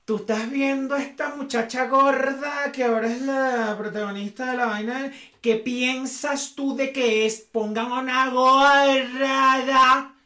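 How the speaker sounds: put-on a mother fishing for her teen's disapproval; loaded question from mom. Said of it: "con la ceja así".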